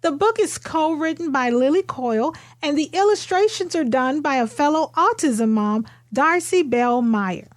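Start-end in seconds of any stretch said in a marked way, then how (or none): none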